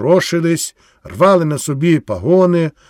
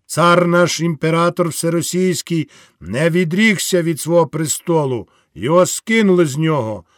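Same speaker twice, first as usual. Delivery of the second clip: rally the peasants with rising passion; soldier's speech to the crowd; fiery soldier orator; 4 semitones higher than usual. Acted. Wounded officer rallying the crowd.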